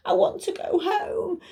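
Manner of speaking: put-on high, plaintive child voice; upset little girl voice. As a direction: plead like a lost small girl. Primrose pleading.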